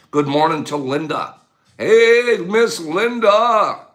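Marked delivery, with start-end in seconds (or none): 1.8-3.9 high, loud and slow, stretching out the greeting; drawn-out cheery hello. Real, not acted. Host greeting a favourite viewer with a big, slow, cheery hello. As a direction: sing out a big happy hello to Linda.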